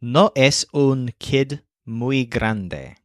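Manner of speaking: put-on No es un kid muy grande spoken slowly, word by word; careful teaching read; slow model sentence. Shown as a demonstration. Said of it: "uses grande to signify grown up".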